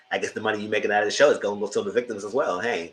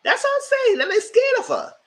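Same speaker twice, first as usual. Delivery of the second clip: squeaky mock voice; 5 dB louder than usual. Mocking the cast's fear.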